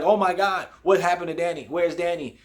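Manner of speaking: high, urgent retold worry; mock panic. Parents unable to reach Danny, panicking.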